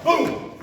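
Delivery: high, loud, sudden "boom"; impact sound. Shown as a demonstration. Teacher demonstrates dodging a second shove, explosive boom. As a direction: punch out a boom for the move.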